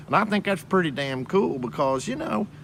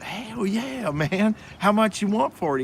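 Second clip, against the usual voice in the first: high-pitched, eager re-enactment; eager acceptance; 9 semitones higher than usual. Retold or imitated. Camera buyer jumping at a used bundle deal, eager. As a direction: jump at the offer, pitch way up.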